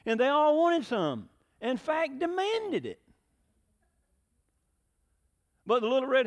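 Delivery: lively, animated storytelling to the congregation; story reading. A narrator's reading. Pastor reads the little red hen fable to the church, animated. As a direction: read it like a children's tale, full of color.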